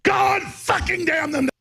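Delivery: slow, high, repeated bellowed curse; hammed-up movie shout; Charlton Heston. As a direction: pound each curse out slowly. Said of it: "He's saying".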